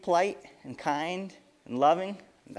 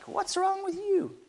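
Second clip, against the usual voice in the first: high-pitched, drawn-out indignant complaint; upset man; slower than usual. Acted. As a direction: whine it out in put-on offence. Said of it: "yanked his foot away and looked upset".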